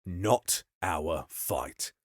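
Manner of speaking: low, very slow, soft refusal; a big man's slow dull answer. Acted. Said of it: "was all the big man said".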